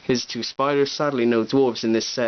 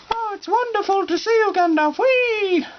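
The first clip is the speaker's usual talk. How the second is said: squeaky hobbit voice; 4 dB louder than usual. Pretend. Voicing toy hobbit greeting.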